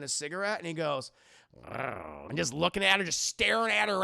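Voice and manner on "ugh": low mock growl; imitated creepy grunt; leering growl. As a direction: let out a low lecherous rumble. Leering driver's noise while staring.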